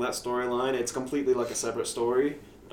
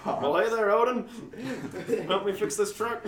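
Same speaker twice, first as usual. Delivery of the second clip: higher, friendly country dad; folksy dad voice; 7 semitones higher than usual. Pretend. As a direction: play Odin's homely dad. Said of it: "Yeah, your dad?".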